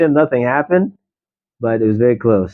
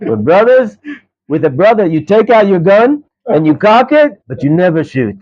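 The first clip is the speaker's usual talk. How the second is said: higher, louder imitated Israeli accent; 9 semitones higher, 5 dB louder than usual. Israeli driver boasts to the student, high and loud Israeli accent. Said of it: "classic Israeli".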